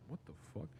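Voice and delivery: quiet confused mutter. Retold or imitated. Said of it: "Raz is like".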